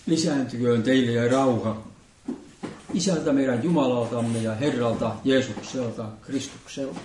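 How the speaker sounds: low, measured blessing intoned to the congregation; liturgical blessing. Acted. Pastor greets congregation with opening blessing, solemn and measured.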